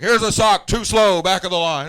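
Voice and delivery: loud, high shouted commands; retold yelling down a line of recruits; drill instructor orders.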